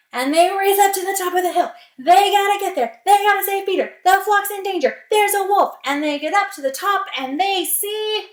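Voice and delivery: loud, high, driving alarm; urgent narration. A narrator's reading. Villagers race to save Peter and flock, urgent. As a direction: push each short line up with urgency. Villagers racing to the rescue again.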